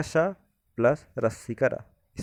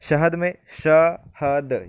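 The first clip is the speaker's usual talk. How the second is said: very slow and louder, stretching शहद; slow syllable demo; 2 semitones higher, 6 dB louder, much slower than usual. Shown as a demonstration. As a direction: draw out शहद syllable by syllable to show its parts. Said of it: "शहद शब्द है".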